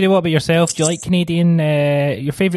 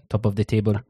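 put-on French accent; 5 semitones lower, 5 dB quieter than usual. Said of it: "in, like, a French accent".

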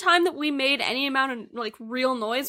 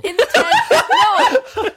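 laughing; 14 semitones higher, 7 dB louder, much slower than usual. Real, not acted. Incredulous laughing denial.

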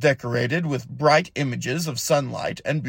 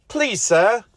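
higher, pleading plea; the elf begs the jar spirit for mercy, pleading higher; begging; 7 semitones higher, 4 dB louder than usual. Acted. High pleading plea. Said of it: "Jaddeth begged".

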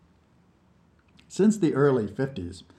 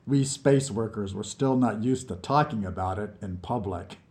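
usual speaking voice

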